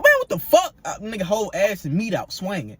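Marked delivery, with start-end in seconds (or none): 0.0-0.7 high, loud cry of disbelief; shocked retold outburst. Looking up at his naked roommate, retold shocked outburst. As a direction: blurt it out in high-pitched shock.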